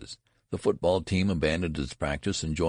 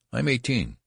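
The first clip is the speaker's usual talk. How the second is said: higher, emphatic insistence; insistent protest; 8 semitones higher, 4 dB louder than usual. A narrator's reading. Benjamin insisting on his age to the registrar, protesting. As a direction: protest it, pitched up.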